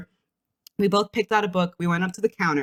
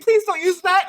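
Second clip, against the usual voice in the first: pleading clerk; 10 semitones higher, 5 dB louder than usual. Retold or imitated. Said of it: "He's like".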